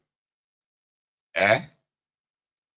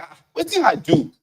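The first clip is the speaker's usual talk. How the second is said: mock innocent wife; 12 semitones higher, 3 dB louder than usual. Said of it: "will now be like".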